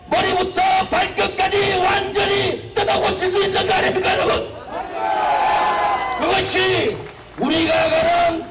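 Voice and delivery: high, impassioned rally shouting; roaring rallying speech. Real, not acted. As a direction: preach it to the crowd at full force.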